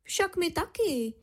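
high-voiced mama reply; lightly squeaky mum; mouse mother. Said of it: "řekla máma".

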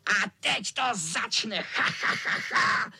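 high announcement into evil laugh; hammed-up villain cackle; shouting tomcat villain. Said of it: "křičel kocour".

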